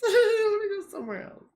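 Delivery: high, laughing whine; whiny voice. Pretend. Venus person bored of Pluto, high laughing whine. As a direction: whine like you're bored and leaving.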